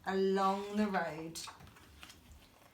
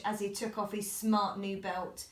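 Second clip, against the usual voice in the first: ordinary delivery, nothing marked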